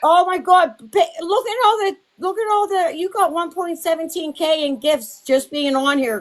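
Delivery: excited. Real, not acted. Gushing over gifts on stream.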